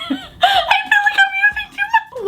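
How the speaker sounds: laughing through the words, high; giggling helplessly as she speaks. Real, not acted. Laughing at own makeup.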